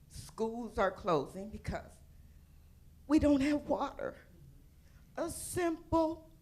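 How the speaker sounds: holding back tears about her hometown; emotional, voice breaking. Real, not acted. Flint native pleading for her city, voice cracking. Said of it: "I'm getting emotional".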